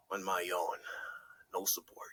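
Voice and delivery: whispered, dejected; lonely whisper. Real, not acted. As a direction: let it drop to a whisper. Alone facing his fear.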